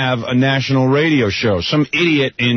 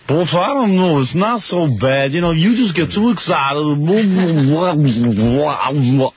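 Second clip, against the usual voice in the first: higher, whiny placating Gary voice; impersonating Gary; 5 semitones higher, much slower than usual. Retold or imitated. Host mocks producer Gary calming him down, higher and whiny. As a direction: mimic Gary brushing off his anger. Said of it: "Is that impersonation as far off".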